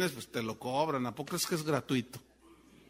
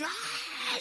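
high-pitched drawn-out mock scream; imitated crowd scream; 4 dB quieter than usual. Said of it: "la gente empezó a gritar".

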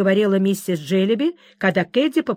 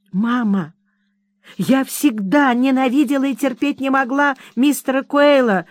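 sobbing; 5 semitones higher, 3 dB louder than usual. Acted. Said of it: "всхлипывая".

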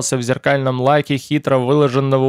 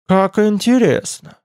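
slow, higher-pitched, intrigued drawl; thoughtful drawl; 6 semitones higher, slower than usual. A narrator's reading. Ruler learning that his guest is barred from the library, intrigued drawl. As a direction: stretch the words out with interest. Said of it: "протянул правитель".

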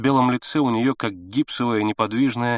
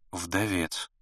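quiet reply; 5 semitones lower, 9 dB quieter than usual. A narrator's reading. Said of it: "тихо ответила Пелагея Ивановна".

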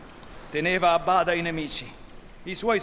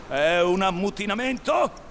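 loud, raised challenge; threatening; 5 semitones higher, 6 dB louder than usual. A narrator's reading. Captain threatens mutinous crew with his hook, threatening. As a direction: challenge them, voice lifted and loud. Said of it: "minacciandoli ad uno ad uno".

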